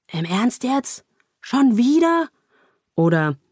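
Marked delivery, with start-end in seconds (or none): 0.1-2.3 higher, rising disbelief; incredulous. Acted. Poker player on tilt exclaiming at another bad hand, incredulous. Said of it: "die ungläubig ruft".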